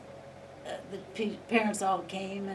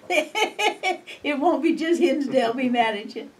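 slow, higher, chuckling through it; laughing quote; 6 semitones higher, 8 dB louder, slower than usual. Retold or imitated. Mother quoting her son's teasing warning, slow and laughing. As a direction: tease like her son, laughing.

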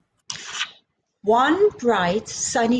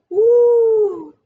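high loud whoop; woo; 11 semitones higher, 7 dB louder than usual. Acted. Bird soaring up to the branch, high whoop. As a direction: swoop up with the bird.

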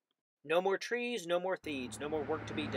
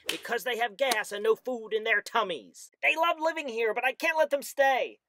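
high, loud, gruff little creature voice; snapping Lorax voice; 7 semitones higher, 6 dB louder than usual. A narrator's reading. The Lorax scolds the Onceler, high loud snapping voice. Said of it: "He snapped".

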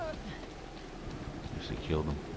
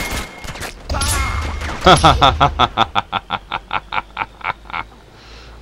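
long, higher, loud cackling laugh; long laughter; 9 semitones higher, 6 dB louder than usual. Real, not acted. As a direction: crack up over the kill. Long laugh after kill.

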